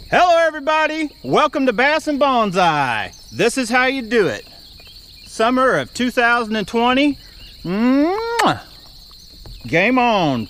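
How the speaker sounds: hyped channel host intro; show intro. Acted. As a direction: pump up the viewers, host style.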